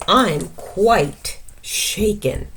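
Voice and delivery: slow, drawn-out fake fright; mock shaken. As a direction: stretch it out playfully. Mouse claims fear.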